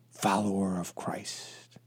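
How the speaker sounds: slow, breathy mock-pious whisper; drawn-out whispered quote of a pious bio; hushed quote. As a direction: whisper the profile line as if it were sacred. Mocking pious Twitter profiles.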